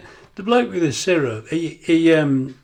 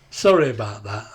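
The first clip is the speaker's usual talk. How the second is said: ordinary talk, nothing marked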